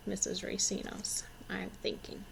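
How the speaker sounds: soft unvoiced whisper; whispered aside. Real, not acted. Teacher whispering an aside to her pupils. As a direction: drop to a whisper as a joking aside.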